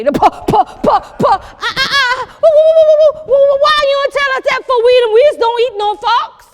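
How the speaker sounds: high, loud, laughing horror; panicked shriek. Acted. Guests find out they ate fox, shrieking. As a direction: screech woo woo in comic panic.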